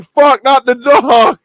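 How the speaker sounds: high, loud, slow wail; anguished cry. Retold or imitated. Retelling the dog movie scene, wailing in mock despair. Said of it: "I'm like".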